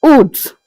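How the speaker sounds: Août as a single "oot"; French pronunciation. Shown as a demonstration. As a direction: say Août in one short syllable with the T sounded. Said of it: "August is pronounced as août".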